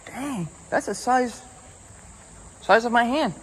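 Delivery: slow, high excited praise. Real, not acted. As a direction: marvel at the fish. Landing a big fish.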